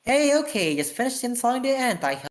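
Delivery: high, bright, cheerful report; perky girl. Acted. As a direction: chirp it out proudly.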